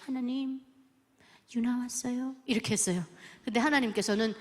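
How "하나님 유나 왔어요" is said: creeping small voice. Retold or imitated. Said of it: "기어 들어가는 소리로".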